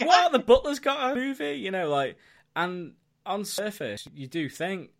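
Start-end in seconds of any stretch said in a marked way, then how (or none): 0.0-1.5 higher, louder scoffing disbelief; mock incredulity. Podcaster voicing his first reaction to a Butler film, mock incredulous. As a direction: scoff at the very idea, pitched up. Mocking reaction to a gag premise.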